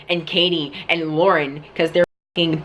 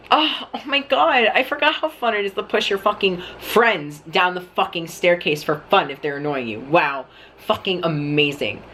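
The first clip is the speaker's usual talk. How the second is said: biting sarcasm. Retold or imitated. To friends who pushed him for fun, heavy sarcasm. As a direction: gush in fake delight, then drop to a dry "amazing".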